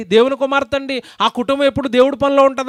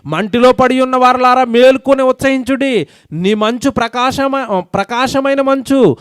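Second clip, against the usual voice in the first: slow, louder pulpit declamation; preacher proclaiming scripture slowly; solemn scripture reading; 4 dB louder, slower than usual. Acted. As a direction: stretch every word of the verse out. Reads the verse aloud.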